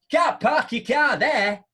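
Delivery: loud, slow order; mock officious telling-off; bossy steward. Steward voiced in quarrel.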